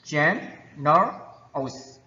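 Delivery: GENEROUS broken slowly into three separate syllables. Shown as a demonstration. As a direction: say "Generous" very slowly, pausing between gen, er and ous. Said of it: "they are three syllables".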